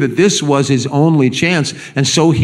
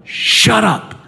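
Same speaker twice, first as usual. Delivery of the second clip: mock hush; 8 semitones higher than usual. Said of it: "what are they saying to him?".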